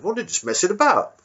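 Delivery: high, timid squaddie voice; a nervous young private answering his officer; meek soldier squeaking up to the colonel. Retold or imitated. Soldier line requoted.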